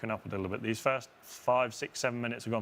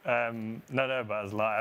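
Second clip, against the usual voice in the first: usual speaking voice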